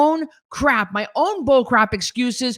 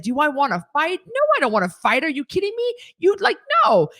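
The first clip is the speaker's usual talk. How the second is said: incredulous refusal; 6 semitones higher than usual. Retold or imitated. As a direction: scoff at the idea, pitch climbing. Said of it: "And I said".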